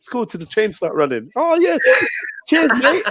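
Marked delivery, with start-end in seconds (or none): 1.4-3.0 high, chirpy thankful guest; grateful guest voice. Retold or imitated. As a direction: thank him all sweet and high. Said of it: "good Samaritan offer, reply".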